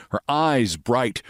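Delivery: usual speaking voice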